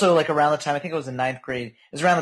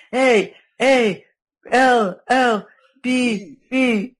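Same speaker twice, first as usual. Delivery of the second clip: mock [name] voice; 9 semitones higher, 5 dB louder, much slower than usual. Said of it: "he's like".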